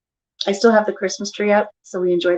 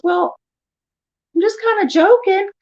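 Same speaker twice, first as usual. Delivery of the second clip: high-pitched imitation of him; retold amused backpedal; 11 semitones higher than usual. Husband excusing his joke to wife, high and sheepish. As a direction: lightly imitate a joker backing off. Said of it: "He starts laughing".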